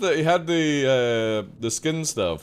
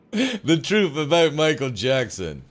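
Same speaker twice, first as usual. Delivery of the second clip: ordinary delivery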